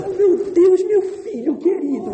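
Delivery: put-on a mother gushing over her baby; doting mother. Mother rising at night for crying baby, gushing high voice.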